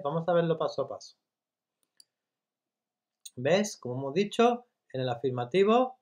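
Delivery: usual speaking voice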